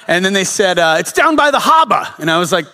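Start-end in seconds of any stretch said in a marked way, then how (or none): 1.0-2.1 high, emphatic mock Boston accent; terrible Boston accent. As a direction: do a hammed-up Bostonian, dropping the r's. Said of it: "I don't know if that accent's good".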